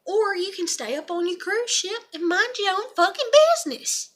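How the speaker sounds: high-pitched, loud, exasperated demand; indignant telling-off. Acted. Rower tells cruise ship onlookers to mind their business, indignant. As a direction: tell them off in a high, protesting voice.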